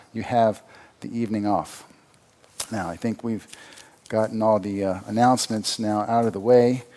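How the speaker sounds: normal talking voice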